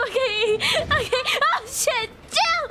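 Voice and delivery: high, frantic bursts; panicking. Real, not acted. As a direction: yelp it while under attack. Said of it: "a little bit of a panic situation".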